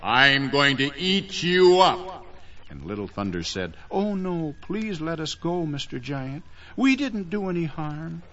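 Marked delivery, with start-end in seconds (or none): 0.0-2.3 slow, booming storybook giant; big menacing monster voice, drawn out; evil giant. Acted. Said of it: "I am the giant Evil Spirit". 3.9-8.2 put-on high, small child's plea; timid, high-pitched begging; pleading little boy. Boy begs giant for mercy.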